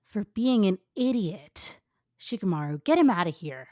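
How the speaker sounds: loud scolding; loud annoyed Sakura; angry Sakura. Acted. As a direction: snap at him then order.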